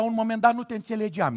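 fast mumble-rush. Shown as a demonstration. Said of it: "repeated take".

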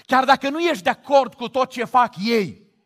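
ordinary talk, nothing marked

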